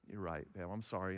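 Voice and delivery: soft, sheepish sorry to his daughter; dad quietly owning up to his little girl; sheepish apology. Retold or imitated.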